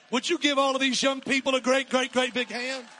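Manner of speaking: loud, high, driving sermon voice; full-force preaching. Acted. Pastor rallying the young people, loud and fervent. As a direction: preach it to the rafters.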